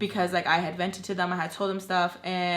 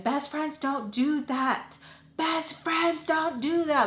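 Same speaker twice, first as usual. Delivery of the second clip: higher, slow, drawn-out mock scolding; in-your-face scolding; 8 semitones higher, slower than usual. Friend scolding her to her face, mock confrontational. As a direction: imitate someone confronting you, dragging the words. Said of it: "Like, in my face".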